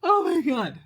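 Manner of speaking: laughing exasperation. Acted. Exasperated outburst.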